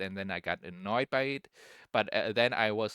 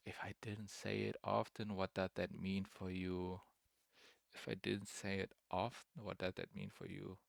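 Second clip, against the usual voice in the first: repeats the question much softer, puzzled; puzzled murmur; 12 dB quieter than usual. Retold or imitated. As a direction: mull it over under your breath. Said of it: "I don't even understand the question".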